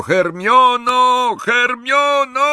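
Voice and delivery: high, long-drawn yell of a name; anguished scream. A narrator's reading. Ron screams for tortured Hermione, anguished yelling. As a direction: scream her name, stretching it out. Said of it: "Ron wrzeszczał tuż obok niego".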